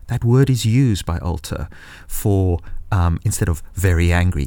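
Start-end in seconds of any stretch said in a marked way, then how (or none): none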